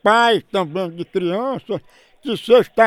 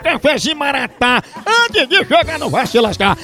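lively radio ad read; 3 semitones higher than usual. Acted. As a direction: sell the coffee brightly. Reads a coffee advert. (leitura de comercial de rádio)

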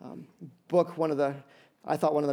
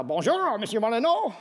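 mock French accent, pitched up; French clerk; 4 semitones higher than usual. Pastor imitating the French hotel clerk, mock French accent.

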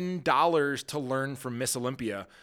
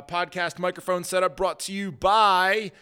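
loud, higher radio announcer read; 5 semitones higher, 7 dB louder than usual. Acted. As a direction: boom it out like a radio sponsor spot. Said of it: "I even put, like, a little radio voice on that".

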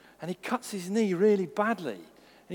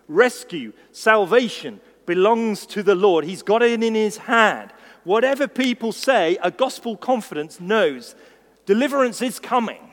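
louder, full-force proclamation; preaching; 5 semitones higher, 8 dB louder than usual. Acted. Preacher proclaiming salvation to the congregation, full force. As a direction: preach it with conviction.

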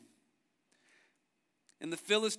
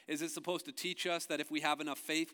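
normal talking voice

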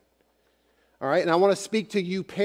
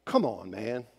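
teasing phrase; 7 semitones lower, 7 dB quieter than usual. Retold or imitated. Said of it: "We would use this phrase".

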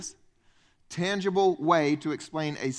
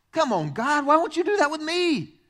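higher, louder pleading whine; mock whiny plea; 9 semitones higher, 4 dB louder than usual. Preacher mimics believers begging God. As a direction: beg God like a whining child.

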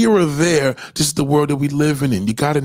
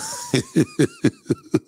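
high laugh. Real, not acted. Laughing at own bit.

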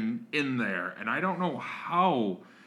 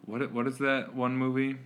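ordinary talk

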